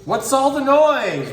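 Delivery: high, loud, slow startled cry. Acted. Little tortoise hears a noisy crowd, startled high cry. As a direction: react to a sudden racket. Surprised character.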